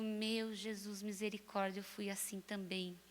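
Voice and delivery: quiet confession muttered to oneself; muttered inward plea. Retold or imitated. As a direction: murmur it under your breath, dropping away. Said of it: "e eu digo".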